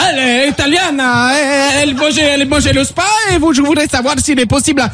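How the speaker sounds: high, singsong mock Italian; Italian accent. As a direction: improvise a phone call in hammed-up Italian. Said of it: "tu passes ton cousin italien".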